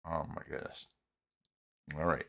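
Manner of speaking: ordinary talk, nothing marked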